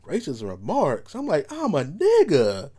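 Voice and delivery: drawn-out, high-pitched disbelief; incredulous reply. Retold or imitated. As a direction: stretch it out in mock astonishment. Indignant reply to racism accusation.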